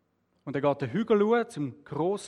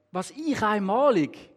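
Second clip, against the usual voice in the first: drawn-out, higher note of disbelief; incredulous question; 4 semitones higher, slower than usual. A narrator's reading. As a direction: question it slowly, pitched up in disbelief. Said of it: "lacht und sagt". (ungläubige, gedehnte Frage)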